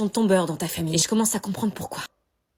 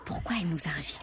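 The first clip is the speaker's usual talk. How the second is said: whispering while hiding; 5 semitones lower, 6 dB quieter than usual. Acted. Whispering about host nearby.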